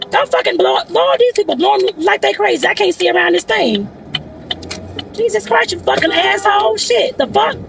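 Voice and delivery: high, cursing outburst at other drivers; real road rage. Real, not acted. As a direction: swear high and sharp at the honking cars. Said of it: "I'm sorry, y'all".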